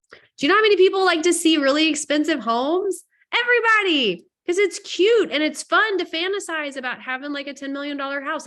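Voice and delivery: thrilled high-pitched enthusiasm; excited gush. Real, not acted. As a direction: squeal it out like you can't contain it. Gushing about luxury homes.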